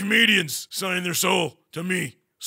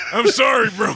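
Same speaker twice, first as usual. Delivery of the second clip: louder and higher, still laughing; laughing apology; 4 semitones higher, 7 dB louder than usual. Real, not acted. Player apologising to his friend mid-laugh, loud and laughing. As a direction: apologise loudly through the giggles.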